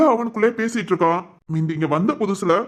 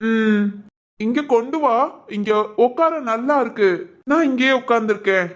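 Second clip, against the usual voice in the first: put-on higher, innocent childlike voice; childlike man; 4 semitones higher than usual. Regressed son to his caretakers, higher childlike voice. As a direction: talk like a puzzled ten-year-old.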